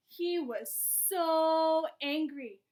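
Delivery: "so" stretched long and loud; stress on SO. A narrator's reading. Narrator telling how angry he was, SO heavily stretched. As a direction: draw out SO with force.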